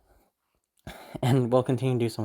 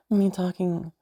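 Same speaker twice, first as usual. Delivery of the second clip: playful teasing lilt, raised a little; 5 semitones higher, slower than usual. Shown as a demonstration. Showing viewers the voice used on a partner, lightly teasing. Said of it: "I switched from my teasing voice".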